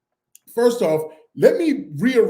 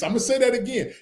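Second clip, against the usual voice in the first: usual speaking voice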